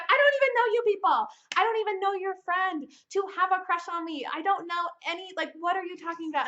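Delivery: high, loud, incredulous; shouting in disbelief; exasperated yell. Retold or imitated. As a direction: blast him for the nonsense. She yells at him.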